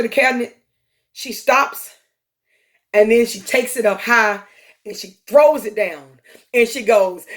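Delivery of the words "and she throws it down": ordinary talk, nothing marked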